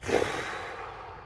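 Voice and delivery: long breathy exhale; letting-out sigh. Shown as a demonstration. Coach shows how to let it out, long sigh. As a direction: blow the feeling out slowly. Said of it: "Even let it out and go".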